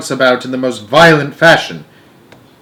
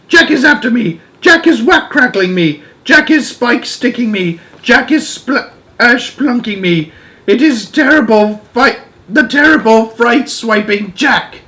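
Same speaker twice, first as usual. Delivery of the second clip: high-pitched giant in a nightmare panic; terrified bellowing; 10 semitones higher, 3 dB louder than usual. Acted. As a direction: wail each line as a fresh attack. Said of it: "bellowed the flesh lump eater".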